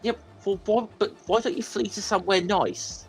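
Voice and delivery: normal talking voice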